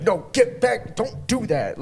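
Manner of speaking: high, loud cranky villain; cranky Joker. Retold or imitated. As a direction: snap like a grumpy villain. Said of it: "kinda, like, cranky".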